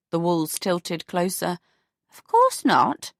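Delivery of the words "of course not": put-on slow, offended old lady; affronted. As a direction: sound affronted. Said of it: "She looked affronted".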